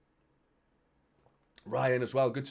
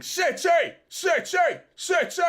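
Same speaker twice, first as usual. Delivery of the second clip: high, loud mock chant of City; imitating a shouted chant; 8 dB louder, slower than usual. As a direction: shout it like a rival fan's sarcastic cheer. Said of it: "Manchester United Football Club shouting".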